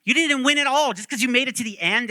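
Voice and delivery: high-pitched, loud irate rant; acted-out furious scolding. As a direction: tear into the kid, piling up charges. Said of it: "he's, like, irate with them".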